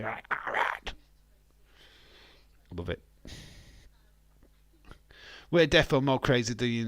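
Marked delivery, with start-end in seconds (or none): 0.3-0.9 mock shout without full voice; rough, half-voiced mock yell; imitated shout. Streamer imitating a chatter who pops in, mock shouting. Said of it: "He shouts at me".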